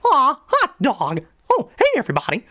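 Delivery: very high, chirpy cartoon mouse; high-pitched, bouncy mouse voice; Mickey Mouse. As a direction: squeak a cheery greeting in falsetto. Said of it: "when I do a high-pitched voice, like, say, Mickey Mouse".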